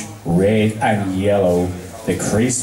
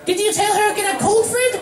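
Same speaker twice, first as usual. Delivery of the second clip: very high, fast excited pony; Pinkie, bubbly and curious; Pinkie Pie; faster than usual. Acted. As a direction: bounce through it eagerly.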